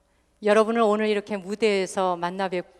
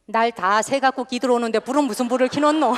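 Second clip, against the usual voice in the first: sharp Gyeongsang dialect comeback, a bit louder; Gyeongsang wife's retort; 3 semitones higher, 5 dB louder than usual. Retold or imitated. Host imitates a Gyeongsang wife scolding her late husband. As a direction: snap back like a Gyeongsang wife. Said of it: "남편이 밤늦게 돌아오면".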